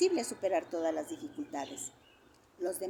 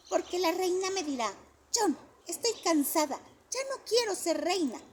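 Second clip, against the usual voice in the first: a lightly voiced child, higher; little boy imagining; 7 semitones higher, 4 dB louder than usual. A narrator's reading. Johnny imagines the queen speaking to him, light child voice. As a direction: daydream out loud like a kid.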